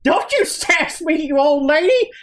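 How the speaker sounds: put-on high old man scolding his wife; old man bickering. Shopkeeper bickers with his wife, high scolding old voice. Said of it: "he goes".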